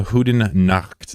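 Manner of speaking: Goedenacht drawn out slowly, with a throaty g and ch; slow, throaty Dutch Goedenacht; Dutch goodnight. Shown as a demonstration. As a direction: hawk the g and ch of Goedenacht from the back of the throat. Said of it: "sounds like I'm hawking up a loogie".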